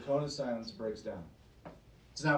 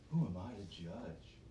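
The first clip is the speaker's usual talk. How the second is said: lower, softer mock shrug of the hypocrite; mock dismissive shrug; 8 semitones lower, 8 dB quieter than usual. Preacher mocking churchgoers who won't judge, dismissive. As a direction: toss it off like someone dodging blame.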